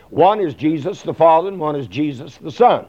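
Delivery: ordinary delivery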